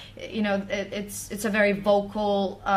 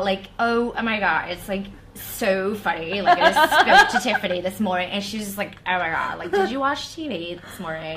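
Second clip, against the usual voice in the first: put-on valley girl. Singer acting a girl gossiping about Tiffany, valley girl voice. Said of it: "like, a valley girl".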